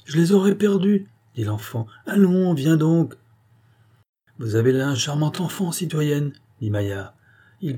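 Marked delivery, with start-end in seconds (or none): 0.0-1.1 higher, a little protesting; a hint of a child's voice; lightly voiced child. A narrator's reading. Child answering the adults about lost coins, lightly voiced. 2.1-3.1 higher, slow insistent call; impatient summons. A narrator's reading. Mother pulling her boy away, impatient. Mother calls the child along.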